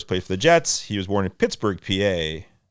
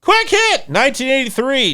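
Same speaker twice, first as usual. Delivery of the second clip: excited announcer call; 13 semitones higher, 4 dB louder than usual. Acted.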